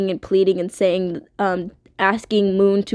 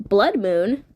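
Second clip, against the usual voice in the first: normal talking voice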